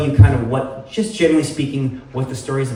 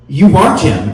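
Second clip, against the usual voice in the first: louder, stress on "are"; insistent contradiction; 3 semitones higher, 7 dB louder than usual. Acted. Giant guy contradicts the mistaken man, insistent.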